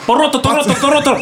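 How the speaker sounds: high mock angry Toronto chant; mock angry outburst, pitched high; imitating Paul's angry voice. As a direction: ham up a mad Paul yelling Toronto. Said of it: "could you imitate it?".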